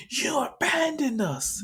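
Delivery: high-pitched indignant complaint, imitated; acted-out group protest; accusing wail.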